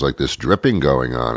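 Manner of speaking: ordinary delivery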